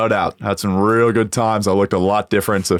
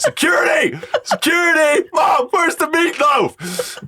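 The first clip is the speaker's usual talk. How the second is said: very high, drawn-out comic yell; hammed-up high-pitched cry for security; mock panicked shout; slower than usual. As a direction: holler for help in a squealing falsetto. Acted panic calling for security.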